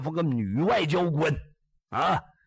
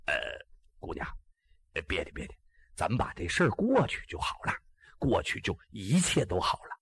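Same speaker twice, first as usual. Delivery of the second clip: much softer and lower, calming; gentle reassurance; 7 semitones lower, 4 dB quieter than usual. Acted. Ji Xiaolan telling the girl to let it pass, in a soft calming voice. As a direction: soothe her quietly.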